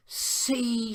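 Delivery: high, gleeful gotcha; a girl pouncing in triumph; triumphant. A narrator's reading. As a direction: crow that she was right. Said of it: "said Emily triumphantly".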